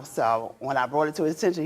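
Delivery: usual speaking voice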